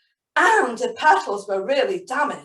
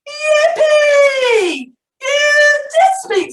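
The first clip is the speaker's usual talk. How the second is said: very high, loud joyful shout; delighted high squeal; shouted yippee; 10 dB louder, much slower than usual. Acted. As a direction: burst out with glee. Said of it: "it shouted".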